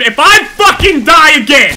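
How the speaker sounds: high, very loud, stretched out; furious yell. Real, not acted. Player who has died three times, furious yelling. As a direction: blow up on every word. Same threat, escalated.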